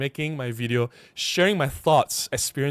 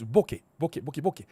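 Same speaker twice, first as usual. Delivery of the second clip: quick, clipped run of "bokeh", tailing off softer; fast imitated bokeh; 5 dB quieter, faster than usual. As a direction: rattle off short "bokeh" in imitation. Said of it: "the way he said it is".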